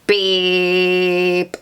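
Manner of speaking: sustained monotone beep; long computer beep. Pretend. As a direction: hold one level electronic note. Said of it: "makes a loud, long beep".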